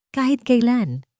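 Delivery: plain reading voice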